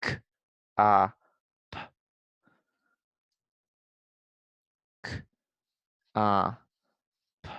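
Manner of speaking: "K", "a", "p" as lone sounds with long pauses; sounding out. Shown as a demonstration. Teacher sounds out cop while writing, sound by sound. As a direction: break cop into "K", "a", "p". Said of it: "Cop I can sound out too".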